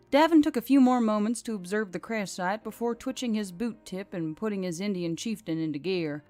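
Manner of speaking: ordinary delivery, nothing marked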